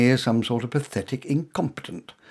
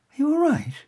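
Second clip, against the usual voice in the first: higher voiced woman, warming as she remembers; curious woman. A narrator's reading. Woman placing the stranger from the theatre, higher and brightening.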